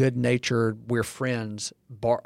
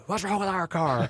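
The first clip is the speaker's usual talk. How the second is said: irritated lashing out; 7 semitones higher than usual. Retold or imitated. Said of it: "And he lashes".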